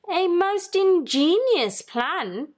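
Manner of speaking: a high, slow touch of an agreeable husband; lightly voiced old man. A narrator's reading. Husband agreeing with his wife's plan, slow and high. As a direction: agree warmly, savoring each word. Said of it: "agreed Mister Grinling".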